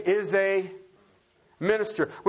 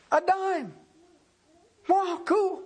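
mock delighted surprise; 10 semitones higher, slower than usual. Pretends to get a free gift.

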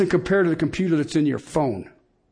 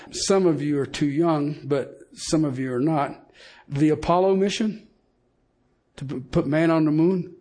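usual speaking voice

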